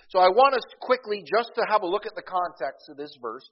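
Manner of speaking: loud, high preaching; preaching at full force. Acted. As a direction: drive the point home to the church.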